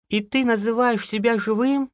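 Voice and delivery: high-pitched, nervy outcry; frightened cry. A narrator's reading. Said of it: "вскрикнула Алиса". (испуганный вскрик высоким голосом)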